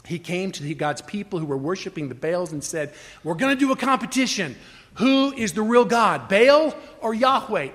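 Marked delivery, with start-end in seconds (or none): 3.2-7.7 higher, slightly louder challenge in Elijah's role; proclaimed challenge. Retold or imitated. Elijah challenging Baal's worshipers to a competition, proclaimed challenge. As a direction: throw down the challenge, lightly acted.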